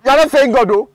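loud and high reproach; scolding. Acted. Officer scolding the driver.

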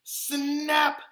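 high, loud sharp snap; punchy storyteller snap; sudden snap. A narrator's reading. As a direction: bite the word off suddenly. Something snaps the grasshopper.

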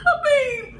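high burst of laughter; laughing. Real, not acted. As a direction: crack up mid-sentence. Laughing at joke.

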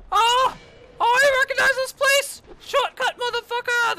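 very high, loud gleeful yell; ecstatic shout. Real, not acted. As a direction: shriek with delighted relief. Recognizes shortcut, thrilled.

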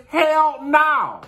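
loud, high, emphatic refusal; emphatic hell no. Real, not acted. Answering the sister and brother-in-law with a loud, high "hell no". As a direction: blurt it out with a high-pitched "hell no".

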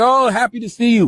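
imitating parents. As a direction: greet them like a parent at the door. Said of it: "they're like".

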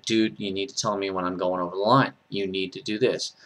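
calm request. Retold or imitated. Man telling people what he needs from them, calm request. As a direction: tell them quietly what you need. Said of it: "I just told people".

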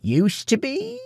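very high, thin croak; croaked question. A narrator's reading. Beggar queries the farmer's remark, high croak. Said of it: "croaked the beggar".

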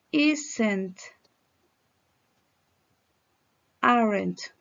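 "Isn't", "Aren't" each said alone, carefully; careful teacher's model of the contractions; contraction drill. Shown as a demonstration. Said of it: "Ahora con contracción".

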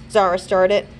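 plain reading voice

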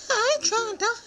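tiny high squeaky mouse voice. Acted.

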